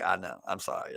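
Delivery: low, soft, cowed reply; quickly humbled, voice dropped low; meek apology. Retold or imitated. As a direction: imitate a chastened kid backing down. Cowed teenager apologizing, retold.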